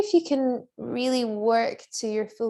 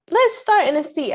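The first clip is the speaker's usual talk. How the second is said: high, loud call to start; loud announcing; 9 semitones higher, 8 dB louder than usual. Real, not acted. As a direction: call it out to the room. Teacher restarts class announcement.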